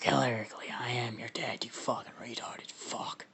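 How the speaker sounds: whispery, hushed monster voice; Venom. Acted. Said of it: "I gotta do my deep voice".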